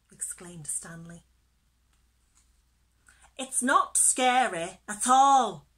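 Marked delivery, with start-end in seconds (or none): 3.4-5.7 loud, cocksure bragging; boastful. A narrator's reading. Fish showing off in the cave, loud boast. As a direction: brag it out with heavy emphasis. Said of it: "boasted Percy".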